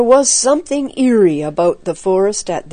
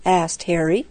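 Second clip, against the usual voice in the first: ordinary delivery, nothing marked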